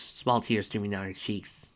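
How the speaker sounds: plain reading voice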